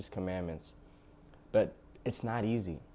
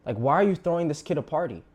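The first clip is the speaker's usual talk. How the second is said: higher and louder, indignant; furious son; 6 semitones higher, 6 dB louder than usual. Retold or imitated. Older son confronting his father about the party, indignant. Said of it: "He's like".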